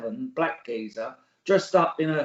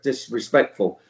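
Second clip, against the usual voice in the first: usual speaking voice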